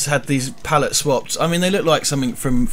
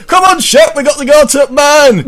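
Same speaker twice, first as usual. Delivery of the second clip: a much higher, louder northern call; laughing northern English shout; 12 semitones higher, 9 dB louder than usual. Acted. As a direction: yell for the dog in a broad northern accent. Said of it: "Northern Spider-Man".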